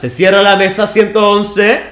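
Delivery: projected, drawn-out ballot-station call; polling official's voice. Shown as a demonstration. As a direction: announce it like a polling officer closing up. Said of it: "No, con voz de vocal".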